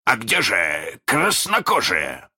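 slow, high demanding captain; Captain Hook. A narrator's reading. Hook suddenly asks his crew, slow high voice. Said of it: "спросил он вдруг".

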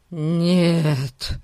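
drawn-out protesting "no"; refuse, clinging to what you hold; exclaimed refusal. A narrator's reading. Old man clings to his sack, exclaiming in protest. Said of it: "воскликнул Фати, вцепившись в мешок".

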